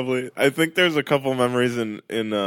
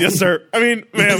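laughing mock recruit; 7 semitones higher, 5 dB louder than usual. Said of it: "Even to women".

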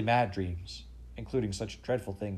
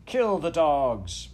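slow, much louder savage command; queen ordering the slaughter, furious; 7 semitones higher, 9 dB louder, much slower than usual. A narrator's reading. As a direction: spit each word at the enemy. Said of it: "cried the Queen".

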